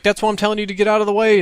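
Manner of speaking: high, fast, loud retort; imitated screaming. Austin telling friend to move aside, exasperated yelling. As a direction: yell it back impatiently. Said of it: "he's screaming at me".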